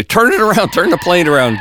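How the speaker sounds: mock urgent order. Urgent order to transit company.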